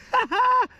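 a short, high laugh; amused laugh. Real, not acted. Camper laughing about the wine left behind. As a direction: chuckle at the stranger's gift.

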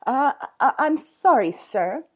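loud, nervous stammer; spluttering. Acted. Zookeeper nervous of the owner, spluttering. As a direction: stumble into the apology, high then dropping. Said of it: "spluttered Winston".

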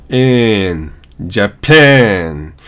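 ジャパン with a long 'æ' in the second syllable; American-style Japan. Shown as a demonstration. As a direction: stretch えん, then ジャパン, between 'ah' and 'eh'. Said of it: "あとAの中間".

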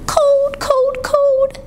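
put-on very high baby wail; shivering babies. Shivering babies to Jack, high wail. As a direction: whimper high, as if chilled. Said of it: "said the shivering babies".

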